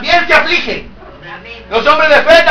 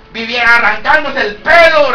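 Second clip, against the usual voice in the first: ordinary talk, nothing marked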